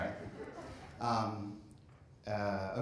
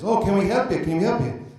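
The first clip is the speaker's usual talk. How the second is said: bad Irish accent; 6 semitones higher, 8 dB louder than usual. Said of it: "bad Irish accent".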